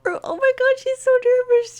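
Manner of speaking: very high, loud squeal; loud high-pitched outburst; excited squeal. Real, not acted. Storyteller squeals over nervous character.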